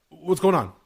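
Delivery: flustered dad answering the phone. Retold or imitated. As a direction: cut her off, frazzled.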